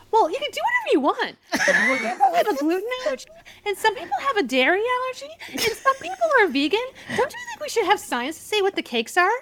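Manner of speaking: very high, squeaky mock politeness. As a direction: imitate a pushy squeaky-voiced woman. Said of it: "little Miss Squeaky Voice".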